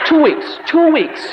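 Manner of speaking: high, loud hammed-up sentence; mock grounding outburst. Dad grounding his son in church, mock-outraged shout. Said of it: "this is what I would normally would have done".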